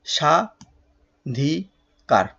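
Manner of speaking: স্বাধিকার drawn out as sha, dhi, kar; long gaps inside স্বাধিকার, very slow; three separate syllables. Shown as a demonstration. Teacher dividing the word in three, স্বাধিকার syllable by syllable. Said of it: "তিন ভাগে ভাগ করা যাচ্ছে".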